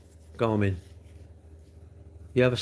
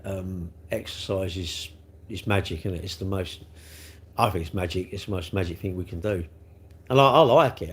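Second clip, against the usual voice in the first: ordinary talk, nothing marked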